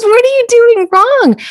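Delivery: high, teasing disbelief; mock the friend's amazement at being alone; incredulous friend. Friend teasing her about sitting alone, high incredulous voice.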